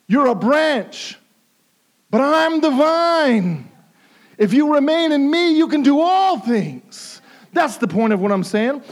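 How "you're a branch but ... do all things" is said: earnest plea. Acted. Preacher speaking as Jesus, pleading.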